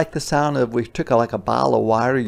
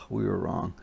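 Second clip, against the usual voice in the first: ordinary delivery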